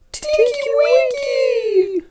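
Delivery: very high, slow loud wail; drawn-out squeaky cry; crying Teletubbies. Acted. Teletubbies wailing for their brother, very high. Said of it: "cried the three Teletubbies".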